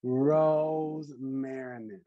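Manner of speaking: Rosmarinus stretched out very slowly, roz-MAIR-in-us; slow encouraging repeat. Shown as a demonstration. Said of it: "It's perfect. You're fine".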